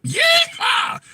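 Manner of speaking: high, shouted yeehaw; loud rodeo-style cheer; cowboy whoop. Real, not acted. Host celebrates with a cowboy whoop.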